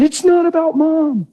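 put-on high, slow, pleading boy's voice; a distraught fourteen-year-old pleading with his dad, high; teenage son's voice. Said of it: "she said".

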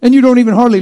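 loud, high, driving preacher voice; full-force preaching. Real, not acted. Preacher pressing the point on the congregation, loud and high.

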